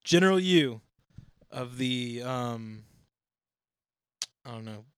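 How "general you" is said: loud, high echo; mock announcing. Host playfully echoes co-host's phrase, mock announcing. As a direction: proclaim "General you" like a title.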